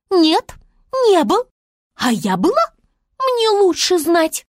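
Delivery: высоко и громко, с вызовом; возмущённый вопрос звёздочки; Звезда спрашивает. A narrator's reading. Звезда спорит. (Звезда наседает на Медвежика, высокий крик)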